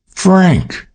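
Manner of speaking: startled exclamation, falling off; shocked cry. Acted. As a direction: gasp his name out in disbelief. May startled by his revelation.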